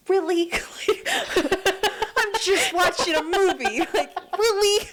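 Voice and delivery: giggling through the words; laughing. Real, not acted. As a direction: laugh while you say it. Laughing at sleep-talking husband.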